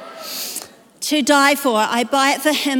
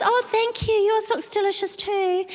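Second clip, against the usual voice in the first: woman's polite reply; 7 semitones higher than usual. Retold or imitated.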